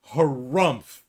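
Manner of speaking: loud mock snort of indignation; huffy harumph. Mocking Mike's offended huff, hammed-up snort. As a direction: huff it out like a sulky hero.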